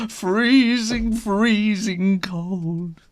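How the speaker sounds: high, stretched freezing; mock shiver. A narrator's reading. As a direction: sound half-frozen. Cold room, shivering.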